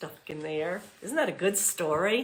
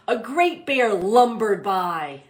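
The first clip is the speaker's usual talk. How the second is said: put-on slow, louder read-aloud to children; storybook reading; 3 semitones higher, 9 dB louder, slower than usual. A narrator's reading. Teacher reading The Mitten to class, slow storybook voice. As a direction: draw out the bear lumbering by.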